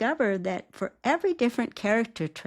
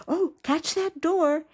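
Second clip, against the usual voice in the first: higher and louder, cheerful nudge; cheerleader voice; 7 semitones higher, 3 dB louder than usual. Shown as a demonstration. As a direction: cheer them into kindness. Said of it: "your character's cheerleader".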